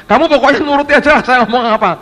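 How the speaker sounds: high, loud and fast; the preacher orders the interpreter to just translate, exasperated loud order. Retold or imitated. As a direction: bark the instruction at him. Scolding the interpreter in a retold scene.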